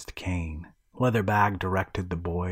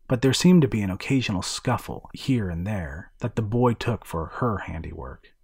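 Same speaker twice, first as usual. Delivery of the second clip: ordinary delivery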